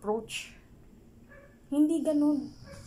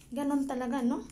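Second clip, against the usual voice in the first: ordinary talk, nothing marked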